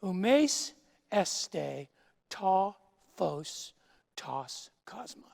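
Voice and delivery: "Umes este ta phos tos cosmos" read as oo-mace ess-tay tah fohs tohs koz-mos, falling off at the end; Koine Greek reading. Shown as a demonstration. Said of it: "Any first-year Greek student ... could translate that sentence".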